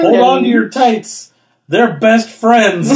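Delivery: loud, high, slow gushing; hammed-up fan excitement; excited quote. Quoting a Reddit comment.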